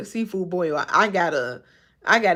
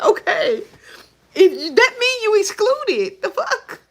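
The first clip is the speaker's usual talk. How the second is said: very high, loud, stretched-out protest; exasperated rant; 13 semitones higher, 6 dB louder, slower than usual. Real, not acted. Heated rant about seafood allergies.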